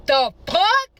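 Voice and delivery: loud, high acted outburst; outraged disbelief. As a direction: explode at the rival's claim. Retold reaction to rival's claim.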